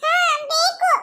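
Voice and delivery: put-on high little-girl voice; acted child's eager yes. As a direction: answer mother in a high childish pitch. Child answers that she wants paint.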